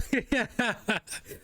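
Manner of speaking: high, rapid-fire chuckling; amused laughter. Real, not acted. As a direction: crack up after your own comment. Host laughs after his remark.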